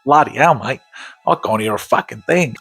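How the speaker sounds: put-on Australian accent, joking and overdone; mock Australian accent. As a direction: play a deaf Aussie bloke, hammed-up Australian.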